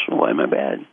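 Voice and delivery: low, drowsy mumble; lightly acted just-woken voice; sleepy monk. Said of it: "Sleepy monk says".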